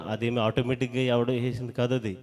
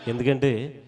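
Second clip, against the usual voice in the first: ordinary talk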